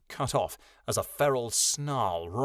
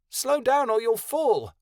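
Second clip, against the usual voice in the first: high, loud, drawn-out call; slow, high-pitched shout; 13 semitones higher, 7 dB louder, slower than usual. A narrator's reading. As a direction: call a warning after someone running off. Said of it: "Kia called".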